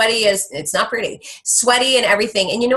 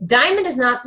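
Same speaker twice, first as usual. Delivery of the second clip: normal talking voice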